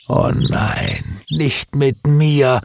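slow, drawn-out mild lament; mild wailing. A narrator's reading. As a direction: moan it in dismay. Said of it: "jammerte Stefan".

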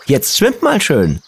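put-on high, slow child voice; boy talking to fish. A narrator's reading. Boy urging his new goldfish on, high and slow. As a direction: coax the fish gently as a little boy. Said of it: "rief er".

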